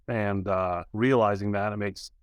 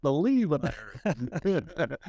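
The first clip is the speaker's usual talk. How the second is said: laughing; 3 semitones higher, much slower than usual. Retold or imitated. Retelling call to friend, laughing.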